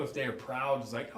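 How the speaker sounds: ordinary talk, nothing marked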